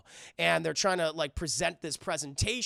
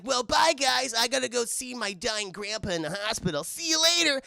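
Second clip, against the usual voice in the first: put-on cheerful teen voice; 5 semitones higher than usual. Itadori leaving cheerfully.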